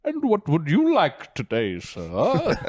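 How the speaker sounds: a Renaissance fair vendor asks a customer, fake British accent. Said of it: "putting on a fake".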